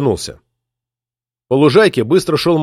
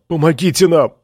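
pleading cry; 5 semitones higher than usual. A narrator's reading. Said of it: "умоляюще закричал".

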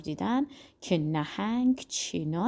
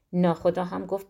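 ordinary delivery

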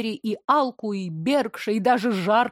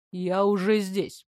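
lower, slow, smooth man's voice; low velvet male voice; 3 semitones lower, slower than usual. A narrator's reading. Mayor arrives behind the heroine, low velvet male voice. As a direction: announce yourself calmly and low. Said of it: "низкий бархатный голос мужчины".

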